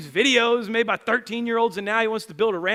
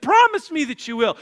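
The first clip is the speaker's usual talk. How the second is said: acted loud, high demand; retold pleading; 8 semitones higher, 8 dB louder than usual. Father begs son for a promise, loudly pleading. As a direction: press urgently for a promise. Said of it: "I'm pleading for his action".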